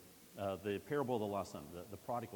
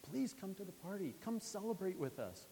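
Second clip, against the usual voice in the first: pleading father; 10 semitones higher than usual. Acted. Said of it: "he pleads with him".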